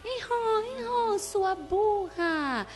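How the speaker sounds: high, slow braying donkey voice; teacher braying at a pupil, high and drawn out; donkey bray imitation. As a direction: bray like a donkey to mock her. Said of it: "Ela começou a imitar um burro".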